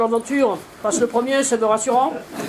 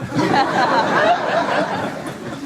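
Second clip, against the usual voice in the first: relieved real laugh; 7 semitones higher than usual. Real, not acted. Mother laughs off her terrifying jump, relieved laugh.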